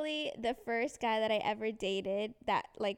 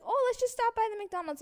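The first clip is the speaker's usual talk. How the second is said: high, casual mom voice; pitched-up breezy retold mother; imitated mom; 11 semitones higher, 4 dB louder than usual. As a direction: play mom making an innocent suggestion. Imitates mom suggesting detour.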